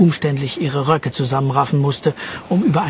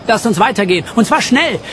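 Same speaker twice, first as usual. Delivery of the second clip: higher, urgent command; urgent order; 6 semitones higher, 4 dB louder than usual. A narrator's reading. Jean urges the others on before the storm, urgently. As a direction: hurry the group along. Urging the group away from storm.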